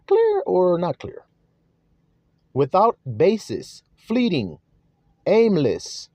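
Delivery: each word set apart with long pauses; slow listing. Shown as a demonstration. Preacher defining a word for the flock, slow and deliberate. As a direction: lay out the meanings one by one, slow and deliberate. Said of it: "let's see what Matthaios means".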